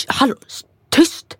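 high, sharp hushing, voice jumping up on tyst; mock hushing boyfriend. Boyfriend hushing her in the shop, high and sharp. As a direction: shush her fast like an embarrassed boyfriend. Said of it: "Han bara".

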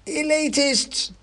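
very high, drawn-out squeaky accusation; mob mouse cry. A narrator's reading. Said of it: "came a voice from behind the cab".

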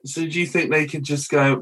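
usual speaking voice

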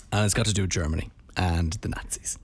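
ordinary delivery

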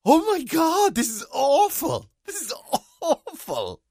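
high, slow, drawn-out this is awful; mock horror. Complaining to the co-host about the show, high and slow with mock despair. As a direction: groan in laughing disbelief at how bad it is. Reacting to Avenue Five.

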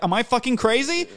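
high, loud disbelieving cry; exasperated outburst. Retold or imitated. Watching footage of his girlfriend, exasperated high cry. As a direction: throw the question up in despair. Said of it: "I feel fucking insane".

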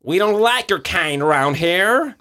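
second try at a tough Texan; American accent. Acted. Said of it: "Kan jeg prøve en gang til?".

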